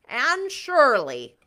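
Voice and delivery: mock Marilla. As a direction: call her name like stern Marilla. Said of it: "reader mocks Marilla".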